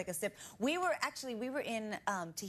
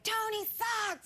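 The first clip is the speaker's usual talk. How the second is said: high, slow jeering yell; mock heckle; 12 semitones higher, much slower than usual. Guest imitating rival fans jeering her fiancé, mock yell. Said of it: "they'll be like".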